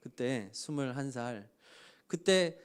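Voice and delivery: ordinary talk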